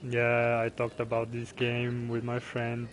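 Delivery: ordinary delivery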